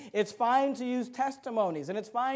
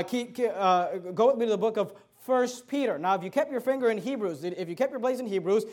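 ordinary delivery

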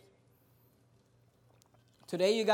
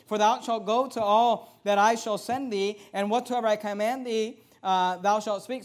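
scripture reading; 5 dB louder than usual. Acted. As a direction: proclaim the verses.

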